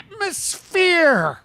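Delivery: high, long-drawn mimicked word; slow, high put-on voice; tipsy woman impression. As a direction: stretch it out in her squeaky voice. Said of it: "she was talking a bit like this".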